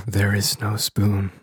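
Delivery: slow, low and soft reading; ASMR. Acted. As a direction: hush it gently into the mic. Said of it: "Let's go A S M R".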